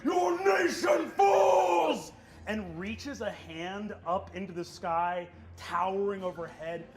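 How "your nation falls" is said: slow, high, loud declaration of war; giant's proclamation. Acted. Giant headmaster declares war on the elves, booming. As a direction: boom it down from the sky. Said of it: "Arthur turns down and says".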